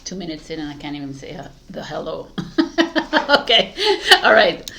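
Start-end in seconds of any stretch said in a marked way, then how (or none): 2.3-3.2 bursting laugh at herself; real laugh. Real, not acted. Vlogger fumbling her intro, laughing out loud.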